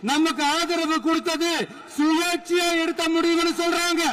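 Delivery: high-pitched rally oratory, climbing as it goes; loud speechmaking. Real, not acted. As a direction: project over a big crowd, taunting the opponents. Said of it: "நான் ஏன் கொஞ்சம் சவுண்டா பேசுறேன்".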